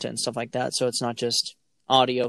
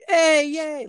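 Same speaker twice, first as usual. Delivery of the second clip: high, loud mock cheer for a toddler; 6 dB louder than usual. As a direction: cheer him on like a proud parent. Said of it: "I'm like".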